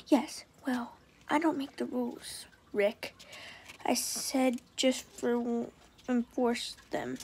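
soft, hushed, slow understanding. A narrator's reading. Girl consoling her sad boyfriend, softly. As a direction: comfort him gently, almost whispering. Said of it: "very understanding".

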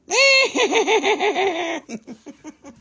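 high cackling witch laugh; witch cackle. Acted. Seller plays witch flyby for Caitlin, high cackle.

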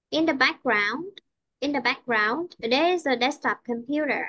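rising on "background", falling to a close on "computer"; teacher's intonation demo, "background" up, "computer" down; up then down. Shown as a demonstration. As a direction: lift "background", then drop "computer" at the full stop. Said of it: "chỗ này có dấu chấm nên cô đi xuống nha".

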